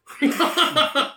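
high laughing echo. Real, not acted. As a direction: squeal it through laughter.

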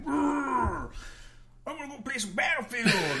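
high, revved-up put-on voice; hammed-up crazy gamer; crazy gamer girl. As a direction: rev up with a trill, then declare it. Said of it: "the crazy ones that are just kinda like".

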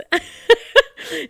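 loud, high real laugh; burst of laughter. Real, not acted. Laughing at the see-through cardigan, real loud laugh. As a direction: crack up at the photos.

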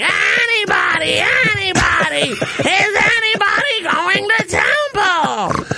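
high, slow, wailing whine; real whiny. Retold or imitated. Friend Stacy in the dream, wailing to the office. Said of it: "Like, real whiny".

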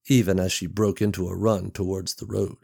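plain reading voice

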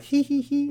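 high, silly tittering hee hee hee; mock giggle. Teachers laughing off the principal's warning, high mock giggle. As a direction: giggle like flirting teachers caught out. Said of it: "they're, like, laughing".

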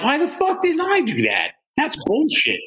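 high jokey self-scolding; mock regret. Friend jealous of the prank, high mock regret. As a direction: scold yourself for missing the prank.

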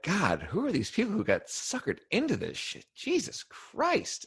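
exasperated disbelief. Retold or imitated. Exasperated reaction to cult members.

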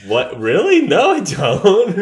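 high, loud mock disbelief, slow at the end. Retelling the Canada trip, mock shocked denial. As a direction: play it up as shocked and offended. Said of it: "And I'm like".

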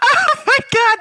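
high, crying with laughter; laughing to tears. Real, not acted. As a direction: gasp it out through tears of laughter. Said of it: "my eyes are watering".

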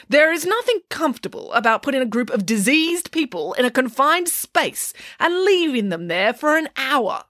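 high, emphatic argument; arguing. A narrator's reading. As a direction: press the point with indignation. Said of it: "argued Nanny Piggins".

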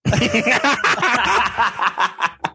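high villainous laugh; evil cackle. Real, not acted. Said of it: "like, an evil cackle right now".

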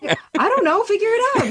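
high, exasperated imitation of a friend. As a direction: toss it back, voice pitched high. Friend's exasperated reply, retold.

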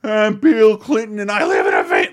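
loud shouted comic impression; Chris Farley shtick shout. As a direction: yell it like a manic comic. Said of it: "Chris Farley doing".

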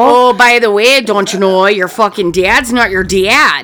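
loud blurting mother voice; loud mock mom. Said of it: "acting the mother's reveal".

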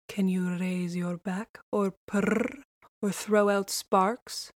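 slow, sly tomcat drawl; lazy cocky street cat; streetwise alley cat. A narrator's reading. Tomcat interrogates the duckling.

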